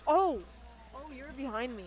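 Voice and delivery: usual speaking voice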